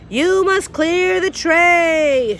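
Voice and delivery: loud, slow, spelled-out shout; Cook shouting. Acted. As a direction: bellow each word slowly at a dim girl. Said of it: "shouted Cook".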